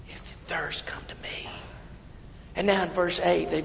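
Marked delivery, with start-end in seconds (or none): 0.1-1.5 soft, breathy whisper; whispered invitation. Acted. Preacher quietly voicing Jesus inviting the thirsty, whisper. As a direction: whisper it like a secret call.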